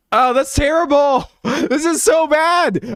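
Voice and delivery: high squealing laugh through the words; laughing shriek. Real, not acted. Streamer laughing at her own botched edit, high shriek. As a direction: shriek it out while cracking up.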